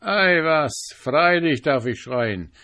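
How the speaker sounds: defiant cry. Acted. Carnival defying the sentry, loud and proud. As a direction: proclaim your royal name proudly. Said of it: "rief der Mann".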